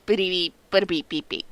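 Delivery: bleep noises in a sing-song; censor bleep. Retold or imitated. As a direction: imitate a censor beep. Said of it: "me probably going bleep".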